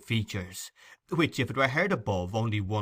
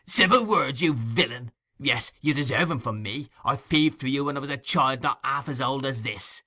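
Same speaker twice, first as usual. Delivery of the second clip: high, loud, scornful fury; furious tirade; 3 semitones higher, 4 dB louder than usual. Acted. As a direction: rage at him without holding back. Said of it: "whose passion was frightful".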